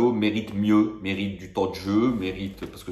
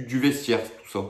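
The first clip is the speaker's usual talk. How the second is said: usual speaking voice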